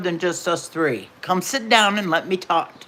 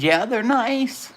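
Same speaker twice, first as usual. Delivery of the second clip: slow, higher, drawn-out half-hearted praise; lukewarm approval; 6 semitones higher, slower than usual. Retold or imitated. Mother answers daughter about unwanted gift rockers, lukewarm approval. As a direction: stretch it out like polite, reluctant approval.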